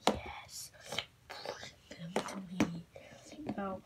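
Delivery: sinister whisper; eerie voiceless whisper. Acted. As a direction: breathe it like a hidden villain. Whispering villain voice.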